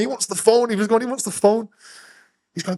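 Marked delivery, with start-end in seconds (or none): 0.0-0.7 a light, higher Brummy imitation; half-hearted Birmingham accent. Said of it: "one of them was Brummy". 1.0-1.7 a light, higher Brummy imitation; half-hearted Birmingham accent. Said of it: "one of them was Brummy".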